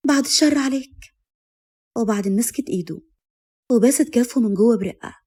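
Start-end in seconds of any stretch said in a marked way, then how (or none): 0.1-1.1 lightly voiced wife, higher pitch; high, loving woman's voice; tender protest. A narrator's reading. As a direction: ward off the bad thought, pitched up. Wife protests talk of death.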